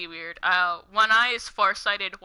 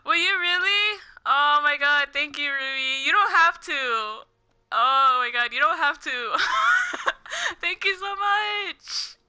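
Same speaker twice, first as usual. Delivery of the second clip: choked up, laughing through tears; teary and overwhelmed; 7 semitones higher than usual. Real, not acted. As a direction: overcome by a kind gift, nearly crying. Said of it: "on the verge of tears because of your kindness".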